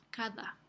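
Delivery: Cada with a soft th-like d; Cada, the d gently voiced through the teeth; soft fricative d. Shown as a demonstration. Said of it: "es esta fricativa".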